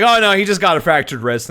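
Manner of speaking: ordinary talk, nothing marked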